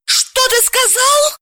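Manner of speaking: very high, slow furious demand. A narrator's reading. Dalila, enraged at her husband's calm. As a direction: spit it out in outrage. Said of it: "разъярилась Далила".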